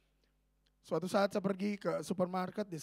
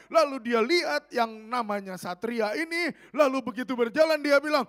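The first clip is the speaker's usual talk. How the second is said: loud, raised preacher's delivery; impassioned preaching; 4 semitones higher, 8 dB louder than usual. Real, not acted. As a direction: drive it out to the whole congregation. Preaches at full force. (khotbah berapi-api dengan suara lantang)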